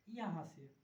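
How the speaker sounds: ordinary delivery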